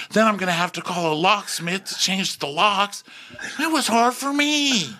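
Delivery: high whiny voice; self-pitying Shep. Retold or imitated. Shep telling Taylor how hard it was, high whiny. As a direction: whine it like a martyr. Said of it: "so he's like".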